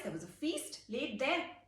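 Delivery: ordinary delivery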